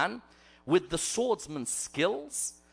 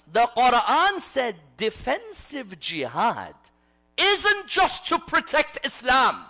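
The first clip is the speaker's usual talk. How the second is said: slow, raised proclamation building higher and louder; forceful preaching; 8 semitones higher, 6 dB louder, slower than usual. Real, not acted. Preacher declares what the Quran said of jihad, forceful preaching. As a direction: proclaim it to the hall, stretching the words.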